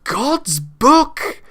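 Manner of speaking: high, loud bellow of disbelief; the king bellows the monk's words back. A narrator's reading. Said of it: "the king bellowed".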